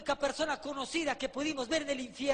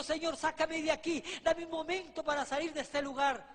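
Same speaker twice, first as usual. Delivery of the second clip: ordinary delivery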